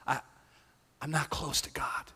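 breathy whispered aside. Shown as a demonstration. Pastor voicing a doubter, whispered. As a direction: whisper it like a confession.

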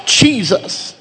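aching, repeated cry of Jesus; gut-level cry. Acted. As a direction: wrench it from the gut. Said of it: "It's a cry from our gut".

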